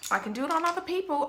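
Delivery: normal talking voice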